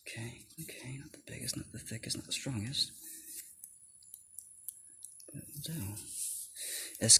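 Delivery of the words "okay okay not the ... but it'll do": soft, half-whispered assessment; hushed verdict. Real, not acted. Judging the cheap shears, hushed near-whisper. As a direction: murmur it almost to yourself.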